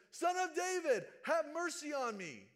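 pleading cry at full voice. Retold or imitated. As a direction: yell it over everyone hushing you. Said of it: "And all the more, he says".

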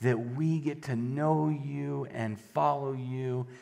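low, soft praying voice; prayer. Real, not acted. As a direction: pray quietly and unhurriedly to God. Opens with a prayer.

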